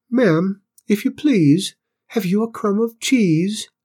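high, polite plea; small squeaky Cinders. A narrator's reading. Cinders begs cook for cheese, small high voice. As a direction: ask high and humbly, rising at the end. Cinders plucked up courage to ask.

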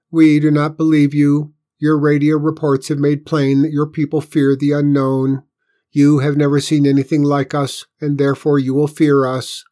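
loud, high, confident alien. A narrator's reading. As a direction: state it as cold certainty. Alien tells Pinkerton.

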